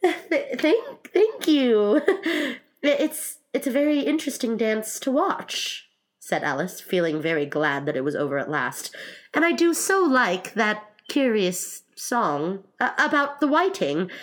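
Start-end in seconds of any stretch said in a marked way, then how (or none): 0.1-5.9 high, halting thanks broken by little laughs; polite Alice. Acted. Alice thanks them for the dance, high and halting. 9.3-14.1 high, slow, hesitant praise; polite Alice. Acted. Alice praises the whiting song, high and hesitant.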